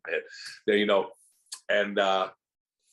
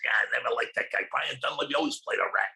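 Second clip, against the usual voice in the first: Mike Trainer; 3 semitones higher, faster than usual. Retold or imitated. Imitating a walking trivia master on old movies, put-on voice. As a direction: imitate a trivia master grumbling about actors. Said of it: "and he talked like this".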